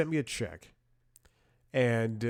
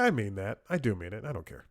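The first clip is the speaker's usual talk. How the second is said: ordinary talk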